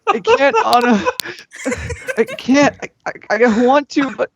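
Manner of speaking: high, breaking voice on the edge of tears; a high sob in every "I can't"; tearful refusal. Acted. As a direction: plead while crying. Elsa refusing, near tears.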